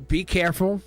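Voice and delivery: normal talking voice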